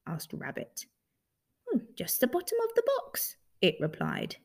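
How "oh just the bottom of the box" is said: very high, quick creature voice; offhand creature. Acted. Creature tells Rabbit what it stands on, quick high voice. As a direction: toss it off fast and high.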